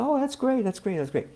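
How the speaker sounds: high cheerful voice of a kind wife; sweet high wife voice. Retold or imitated. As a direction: imitate her pleased reaction way up high. Voicing wife's kind reply.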